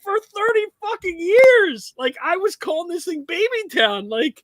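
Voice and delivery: high, loud indignant rant; exasperated rant. Real, not acted. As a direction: vent the disbelief. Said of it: "now I feel fucking stupid".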